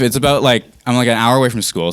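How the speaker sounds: ordinary talk, nothing marked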